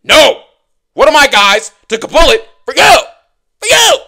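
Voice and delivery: very loud, high hammed-up yell, rising on for you; mock outraged yell. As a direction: scream it like an offended heel. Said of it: "He's like".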